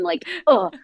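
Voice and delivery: very high gushing ugh; overjoyed groan. Real, not acted. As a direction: let excitement spill out. Overwhelmed with excitement.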